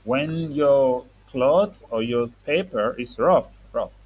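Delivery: put-on slow, careful explanation to a child; slow teaching voice. Shown as a demonstration. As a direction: spell out the meaning slowly. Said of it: "teaching a child words".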